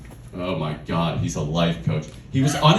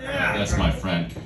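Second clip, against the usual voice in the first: ordinary talk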